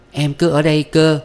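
high-pitched, wilful little plea; insistent young woman. A narrator's reading. Quỳ refuses to go home. (giọng cô gái nằng nặc, cao)

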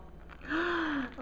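sharp gasp of delight. Real, not acted. Opening her swap box, a delighted gasp. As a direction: gasp at the pink treasures.

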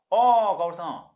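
drawn-out, high, loud call; hailing a neighbour. Acted. Man spots his neighbour at the entrance, hailing her loudly. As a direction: call out a long, high greeting.

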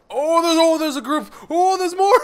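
very high, loud panicked cries; panicked squeal. Real, not acted. Spots a group of enemies.

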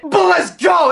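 high celebratory shout; excited celebration. Real, not acted. Player cheering a pull he won't use, excited high shout. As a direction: burst back into cheering. Celebrates despite not using it.

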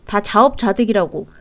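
ordinary talk, nothing marked

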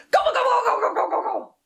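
high, loud gobble gobble; gobbling monster. Pretend. Librarian pretending to gobble up Radcliffe, high and loud. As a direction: gobble the baby up playfully.

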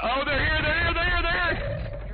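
high, loud alarmed yell; panicked shout. Real, not acted. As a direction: scream the warning. Enemies arrive, panicked callout.

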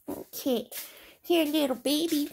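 ordinary delivery

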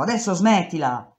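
loud insistent stop; shouting. Retold or imitated. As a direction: yell it again, insisting. (grido insistente)

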